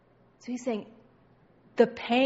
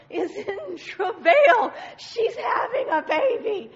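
loud, high, drawn-out cry; exclaiming; 7 semitones higher, 8 dB louder, slower than usual. Acted. Preacher insisting the woman is having a baby, high exclaiming. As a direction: belt it out, voice soaring.

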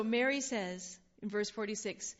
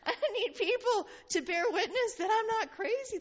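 crying plea; 11 semitones higher, 4 dB louder than usual. Acted. Baby John pleading to be believed.

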